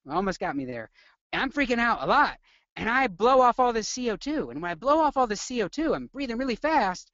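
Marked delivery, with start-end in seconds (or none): 1.3-7.1 high-pitched mock freak-out; demonstrating panic in a raised voice; acted panic. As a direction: play the anxious patient, pitched up. Playing patient having panic attack.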